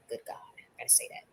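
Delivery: breathy under-the-breath remark; hushed private aside; whispered aside. Real, not acted. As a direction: whisper it to yourself.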